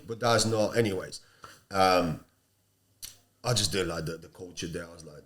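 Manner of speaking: ordinary delivery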